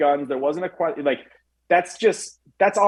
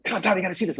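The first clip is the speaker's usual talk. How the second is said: retold excitement, voice pitched up; excited young cop; 6 semitones higher, 4 dB quieter, faster than usual. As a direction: imitate a friend bursting with news. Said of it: "he's all excited".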